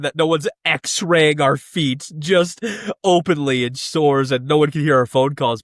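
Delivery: normal talking voice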